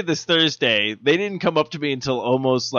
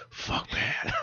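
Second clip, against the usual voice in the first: breathy whispered "Fuck Pat"; whispered curse; 14 dB quieter than usual. Retold or imitated. Manager's unspoken thought about Pat, whispered. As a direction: hiss it under your breath. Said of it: "in his eyes, he's just like".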